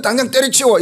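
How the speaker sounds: mock blunt order. Husband telling his upset wife to quit her job, mock blunt order. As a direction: snap it like an impatient husband. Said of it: "이렇게 하면".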